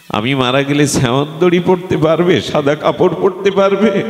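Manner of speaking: high, mounting series of questions; declaimed, insistent questioning; challenging devotee. Acted. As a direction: raise the pitch with each challenge. Preacher voices deity challenging devotee.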